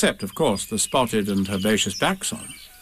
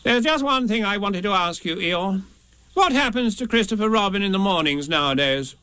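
higher-pitched, businesslike Rabbit about to go; about to leave; 7 semitones higher than usual. A narrator's reading. Rabbit questioning Eeyore before leaving, about to say goodbye. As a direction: raise the pitch, as if shortly saying goodbye. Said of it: "in the voice of one who would be saying goodbye in about two minutes".

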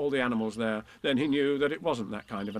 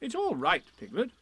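reassuring; 10 semitones higher than usual. A narrator's reading. Comforting Piglet.

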